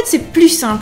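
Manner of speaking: normal talking voice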